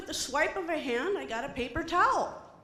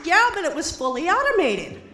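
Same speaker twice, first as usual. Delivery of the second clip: proud comeback; 4 semitones higher, 9 dB louder than usual. Retold or imitated. Comeback punchline.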